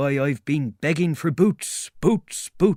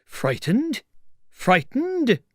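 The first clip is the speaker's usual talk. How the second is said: high, incredulous repeat, rising then louder; outraged tramp's high squawk; indignant echo; 4 dB louder, much slower than usual. Acted. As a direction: throw the word back, affronted.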